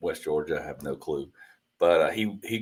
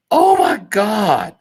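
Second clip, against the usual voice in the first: mock scream; 12 semitones higher, 5 dB louder, much slower than usual. Retelling hearing a scream.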